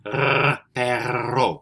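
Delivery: perro with the double R rolled, trilled on the tongue; perro con la erre vibrante. Shown as a demonstration. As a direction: roll the R in perro hard. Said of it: "try to roll your R".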